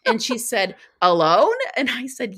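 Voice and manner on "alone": "Alone?" louder, rising in disbelief; French woman, hearing she walks solo, asks "Alone?" in shock. Retold or imitated. The French woman, told she walks alone, asks in shocked disbelief.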